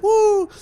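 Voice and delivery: very high mock "Woo!"; the strip-club crowd cheering, squealed high; imitating crowd whoop. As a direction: whoop it up like a rowdy punter.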